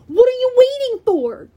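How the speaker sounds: loud, high impatient demand; yell. A narrator's reading. Clarice pushes hesitating hero, impatient yell. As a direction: shout in exasperation. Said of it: "Clarice yelled".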